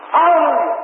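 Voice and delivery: high, ringing preacher's hallelujah; exultant shout of praise. Real, not acted. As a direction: cry it out high to the congregation. Preacher cries out praise mid-sermon.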